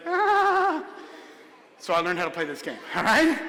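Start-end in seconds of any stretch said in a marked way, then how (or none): 0.1-0.8 much higher frightened mock gasp; startled yelp. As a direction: yelp like you just got cornered. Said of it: "And I was like".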